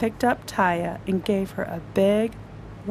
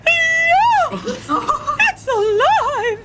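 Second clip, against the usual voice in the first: high, laughing scream; giggling screech, slow and high; screaming lady; 6 dB louder, much slower than usual. Acted. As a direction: shriek it out between laughs. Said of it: "The lady screamed".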